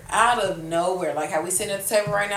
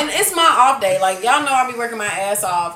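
normal talking voice

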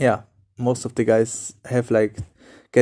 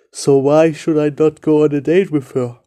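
inner voice; 3 semitones higher, 6 dB louder than usual. Retold or imitated. Imagined self-talk on meeting her.

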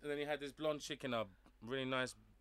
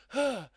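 Mortal Kombat grunt; 9 semitones higher, 7 dB louder than usual. Retold or imitated. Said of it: "where they go".

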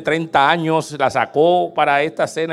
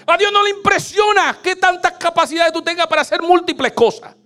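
higher and louder, shouted sermon; full-force preaching; 12 semitones higher, 5 dB louder than usual. Acted. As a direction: drive the point home to the congregation. (predicando a todo pulmón, agudo y fuerte)

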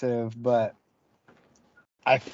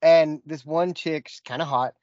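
usual speaking voice

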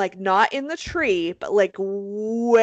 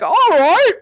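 loud, high-pitched mock acceptance; hammed-up cheerful agreement; gullible cops; 9 semitones higher, 9 dB louder than usual. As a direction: play easily satisfied cops. Retold cops cheerfully accepting her word.

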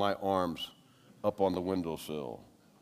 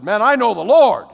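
much higher, loud jubilant cry; joyful shout; 13 semitones higher, 19 dB louder than usual. Retold or imitated. New believer tells the waiting Baptists, jubilant shout. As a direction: burst out with joy. Announcing conversion to waiting group.

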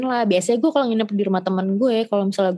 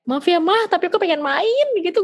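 pleading apology; 9 semitones higher than usual. Retold or imitated.

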